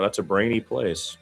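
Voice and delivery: ordinary talk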